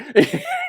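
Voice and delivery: a high burst of laughter. Real, not acted. Laughing at own story.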